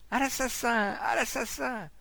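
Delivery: high, ringing alarm call; crying murder. A narrator's reading. Crowd calling the street to a killing, alarmed shouting. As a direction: raise the hue and cry.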